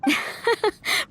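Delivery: amused chuckle. Acted. Reacts to Venti's remark.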